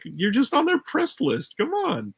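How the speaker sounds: high, exasperated dismissal; incredulous scoff. Real, not acted. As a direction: pitch climbing high in disbelief. Scoffing at the name-dropper.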